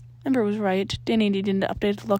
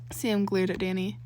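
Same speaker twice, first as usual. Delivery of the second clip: plain reading voice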